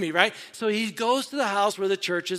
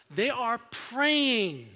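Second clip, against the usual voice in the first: normal talking voice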